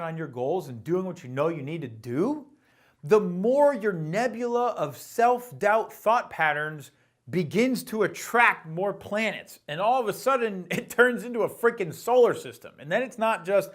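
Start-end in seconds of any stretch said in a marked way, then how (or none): none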